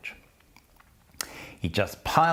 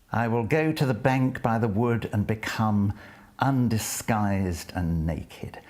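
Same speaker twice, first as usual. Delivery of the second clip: poetry recitation; 3 semitones higher than usual. Acted. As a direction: savour each line as you read it aloud. Lecturer reads the poem.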